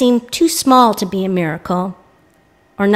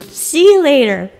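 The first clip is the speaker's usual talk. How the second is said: cheerful pizza guy; 7 semitones higher, 3 dB louder than usual. Retold or imitated. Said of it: "He's like".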